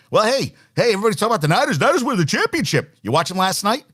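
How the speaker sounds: usual speaking voice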